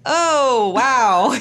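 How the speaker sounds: high, loud drawn-out reaction; mock astonishment. Husband seeing his misspelled tattoo, astonished. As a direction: imitate a stunned onlooker, loudly.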